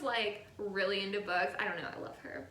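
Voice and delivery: normal talking voice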